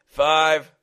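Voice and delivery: loud, high, drawn-out count; countdown. Acted. Gunman counting down at the father, loud menacing count. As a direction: call the number like a threat. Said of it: "the leader counted down".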